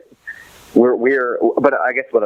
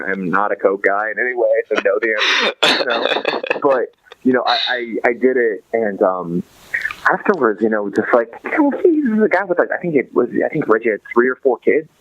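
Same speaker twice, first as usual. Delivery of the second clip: ordinary talk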